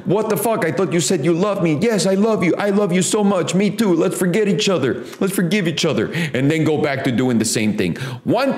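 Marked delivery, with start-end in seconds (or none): 0.0-1.8 higher, indignant mock outburst; confronting husband. Husband confronting his wife again, higher indignant demand. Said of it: "The guy confronts her and goes". 1.8-6.1 higher, sugary imitation of a wife; placating wife. Wife reassuring husband she still loves him, higher sweet mock voice.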